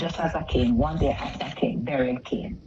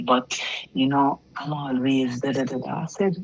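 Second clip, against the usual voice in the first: ordinary talk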